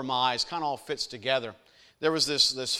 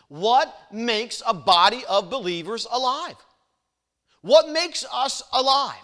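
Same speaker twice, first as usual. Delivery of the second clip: rising preacher's challenge; 6 semitones higher, 6 dB louder than usual. Acted.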